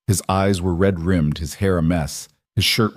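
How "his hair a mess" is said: ordinary delivery, nothing marked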